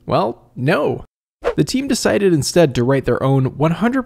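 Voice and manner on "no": normal talking voice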